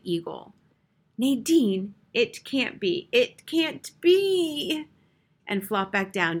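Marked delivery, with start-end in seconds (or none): 1.2-4.9 higher, louder cry, building; aghast, ending slow and drawn out; horrified disbelief. A narrator's reading. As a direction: stretch the last words into a high wail. Horrified invalid wailing to her maid.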